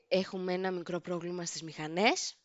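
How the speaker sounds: soft, understated pilot announcement; lightly voiced pilot. Pilot telling passengers of engine trouble, lightly imitated soft announcement. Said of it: "Ο πιλότος βγαίνει και λέει".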